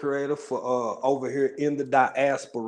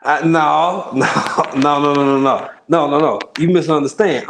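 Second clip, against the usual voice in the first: laughing protest; 3 semitones higher, 8 dB louder than usual. Real, not acted. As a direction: burst out laughing as you insist you were misunderstood. Laughingly protesting a misunderstanding in chat.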